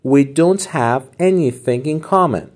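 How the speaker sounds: We don't have anything in common drawn out slowly; slow model phrase. Shown as a demonstration. Teacher models the phrase slowly for students to repeat. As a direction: say it slowly so students can copy.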